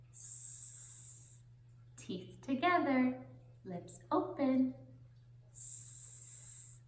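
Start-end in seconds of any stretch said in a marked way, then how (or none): 5.5-6.8 "S" hissed long like a snake. Shown as a demonstration. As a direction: hiss "S" through closed teeth. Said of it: "like a snake".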